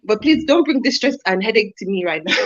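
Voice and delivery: quick, lightly put-on Nigerian accent; mock Nigerian accent. Nigerian accent as a fed-up parent scolding, fast. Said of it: "That's good".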